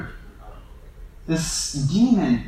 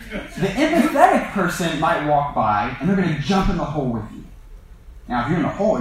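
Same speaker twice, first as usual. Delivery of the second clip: ordinary talk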